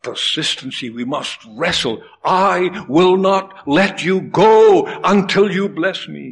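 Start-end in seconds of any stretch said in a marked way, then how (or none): 2.2-6.3 pleading cry. Acted. Jacob wrestling, cries to God.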